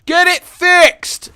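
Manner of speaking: high, slow, loud holler; imitated distant yell, words stretched out; yell from afar. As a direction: shout it across the house. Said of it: "I just hear a yell from across the".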